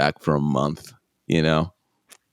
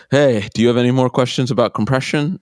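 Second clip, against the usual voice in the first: higher, louder breezy check-in; breezy teacher; 7 semitones higher, 5 dB louder than usual. Retold or imitated. Studio guy checks back on his student, breezy. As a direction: pop back in with a bright, put-on query.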